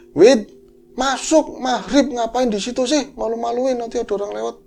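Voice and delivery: high-pitched scolding call; calling child indoors. Acted. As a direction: scold someone lingering outside at dusk. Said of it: "bilangin gitu".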